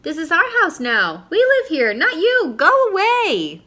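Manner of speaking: put-on high loud bratty kids' yell; yelled back. New children yelling up at Matthew, high bratty shout. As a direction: shout them away like bossy children. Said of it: "they yelled back".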